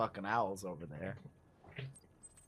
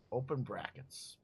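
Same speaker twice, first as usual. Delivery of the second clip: ordinary delivery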